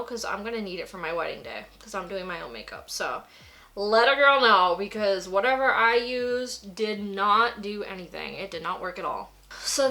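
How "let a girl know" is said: put-on "let a girl know" said louder, stress on "girl"; emphatic repeat. Shown as a demonstration. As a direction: push "girl" harder the second time.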